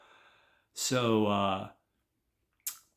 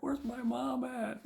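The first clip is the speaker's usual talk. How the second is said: put-on high, slow whine of an upset little kid; mock crying; 3 dB quieter than usual. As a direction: imitate a tearful child looking for mom. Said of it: "He's crying".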